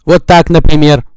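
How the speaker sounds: deliberately blasting the mic; loud demo. Shown as a demonstration. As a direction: shout it to overload the mic. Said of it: "микрофон перегружу". (громко, в перегруз микрофона)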